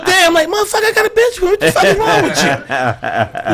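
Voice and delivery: retelling a prank with mock fury; mock outrage. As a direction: play the indignant victim, half laughing. Confronting James over thrown roll.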